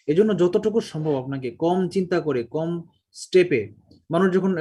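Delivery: normal talking voice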